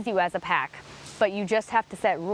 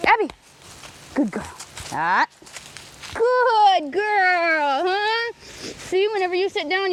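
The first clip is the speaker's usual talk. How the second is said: gushing pet talk; 4 semitones higher than usual. Shown as a demonstration. Owner praising Abby as she jumps and sits, gushing high pet talk. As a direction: coo and gush like the dog is the best in the world. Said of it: "So I'm gonna create that scenario right now".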